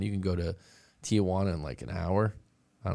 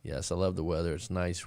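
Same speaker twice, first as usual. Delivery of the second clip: ordinary talk, nothing marked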